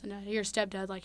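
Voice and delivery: usual speaking voice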